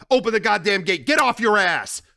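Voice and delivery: Sonny yelling at the gate guard; furious order. Acted. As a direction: bark at the guard.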